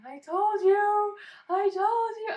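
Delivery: high, loud and slow through happy tears; overjoyed crying triumph. Real, not acted. Mother telling her husband she knew it was a girl, loud and tearful. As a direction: wail it out, told-you-so mixed with sobbing joy. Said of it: "I said".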